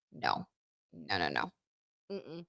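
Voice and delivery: low, hushed string of no's; quiet refusal. Acted. Host reacting to creepy mentor talk, low muttered refusal. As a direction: refuse softly, deep under the breath. Acts out own disapproving refusal.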